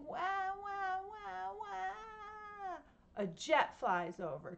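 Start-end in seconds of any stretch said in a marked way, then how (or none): 0.1-2.8 much higher wailing wah, wah; mock baby cry. Shown as a demonstration. Telling kids about a crying baby: high mock wah. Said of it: "A baby crying".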